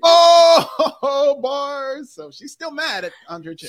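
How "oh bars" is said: high, loud mock gasp of approval; mock crowd reaction. Cohost mimics the crowd reacting to a diss, loud and high. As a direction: ham up the oohing crowd. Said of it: "And this got a big".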